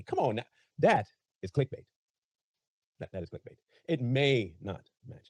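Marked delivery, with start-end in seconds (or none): 3.9-5.3 "may" leaned on, slow and deliberate; stress on may. Shown as a demonstration. Host pointing out the headline's hedge, slow stress. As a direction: spell out the word "may" slowly. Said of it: "that's a big difference between not".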